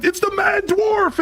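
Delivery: very high, drawn-out mock cry; acted hallway shout. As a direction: herald the dwarf with a hammed-up shout. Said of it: "They'd be like".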